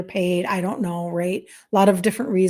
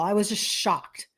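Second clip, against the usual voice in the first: ordinary talk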